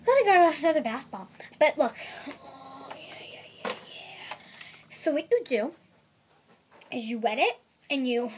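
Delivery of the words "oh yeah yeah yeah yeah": whisper. Real, not acted. Whispered yeahs.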